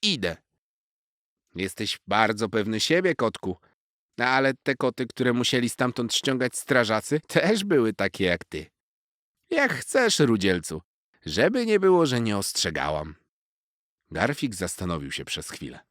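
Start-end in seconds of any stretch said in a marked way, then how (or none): 0.0-0.4 put-on higher, proud young cat voice; boastful cat. A narrator's reading. Young cat bragging to the squirrel, higher voice. 1.5-13.2 high, loud wise little squirrel warning; a cheeky squirrel teasing a show-off kitten; squirrel Zosia. A narrator's reading. Squirrel warning the boastful cat, high and loud.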